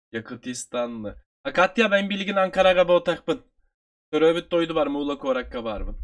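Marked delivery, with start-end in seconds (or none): none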